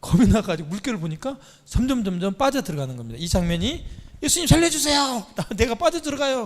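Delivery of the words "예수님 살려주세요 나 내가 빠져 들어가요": high, panicked cry for help; imitated plea for rescue. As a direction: act out a drowning disciple begging. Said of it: "겁이 나잖아요".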